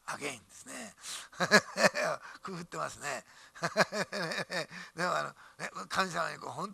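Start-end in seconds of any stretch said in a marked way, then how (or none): 1.0-2.4 short laugh. Real, not acted. Laughs at own joke. 3.3-4.9 short laugh. Real, not acted. Laughs again.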